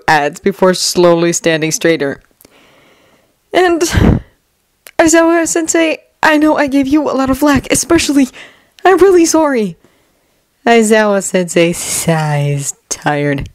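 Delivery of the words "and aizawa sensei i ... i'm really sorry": higher, earnest apology; sincere teen voice. Acted. Izuku apologizing.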